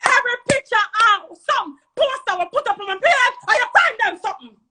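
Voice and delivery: high, heated shouting; real angry shout; angry accusation. Real, not acted. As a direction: fling the accusation out at high pitch. Angry accusation at the page owner.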